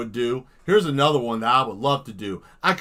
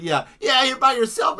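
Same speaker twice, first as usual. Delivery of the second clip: animated voice; 9 semitones higher, 5 dB louder than usual. Acted. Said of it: "Fully voiced and animated".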